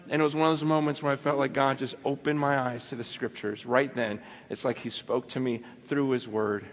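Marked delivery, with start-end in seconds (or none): none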